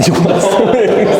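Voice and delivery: laughing through the question; amused, chuckling disbelief; laughing as she answers Tim about eggs. Real, not acted.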